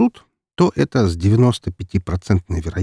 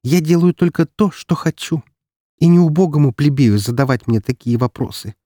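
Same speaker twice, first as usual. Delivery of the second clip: haughty aristocrat; 7 semitones higher than usual. Acted. Aristocrat scolding a commoner.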